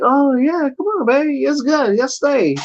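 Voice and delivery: high, coaxing plea to stay; sweet-talking her into staying, pitched high; pleading. Retold or imitated. Husband begging his wife to stay at the party, in a high coaxing plea. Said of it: "I'm like".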